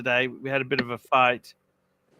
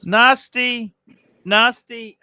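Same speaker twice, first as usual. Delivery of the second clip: nasty, nasty punched high and loud; nasty nasty rising loud and sharp; emphatic repeat; 9 semitones higher, 9 dB louder, much slower than usual. Real, not acted. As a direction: hammer the word nasty twice. Stressing the word nasty.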